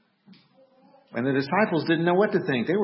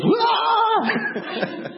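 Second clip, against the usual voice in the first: very high shriek breaking into a laugh; laughing scream. Retold or imitated. Wife Camille startled by the preacher, laughing shriek. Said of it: "And she goes".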